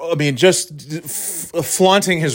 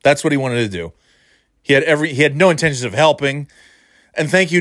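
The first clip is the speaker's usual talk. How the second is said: ordinary talk, nothing marked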